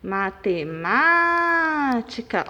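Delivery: matemática with MÁ held very long; mate-MÁÁÁ-tica, sustained stressed syllable; stressed syllable exaggerated. Shown as a demonstration. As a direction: stretch the má of matemática, then finish short. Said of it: "é a antepenúltima".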